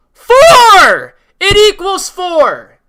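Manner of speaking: slow build then a huge yelled four; exasperated shout. Real, not acted. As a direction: blow up at the obvious answer. Exasperated explaining to Sean.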